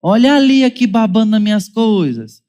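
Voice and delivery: high, loud whiny tattling to dad; child accusing sibling to father, whiny complaint; accusing child's call. Retold or imitated. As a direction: complain to dad like a wronged kid.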